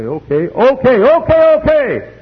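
okays climbing high and loud; acted exasperated giving-in, rising; building surrender. Acts the sinner giving in.

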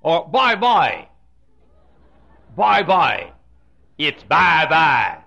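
bye-bye slow and drawn out; slow drawled bye-bye; southern bye-bye. Shown as a demonstration. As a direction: draw out bye-bye slowly. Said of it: "But hi".

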